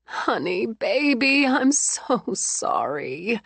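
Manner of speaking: tender comfort. Acted. Mother soothing her hurt daughter, tenderly. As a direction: hold her and soothe her slowly.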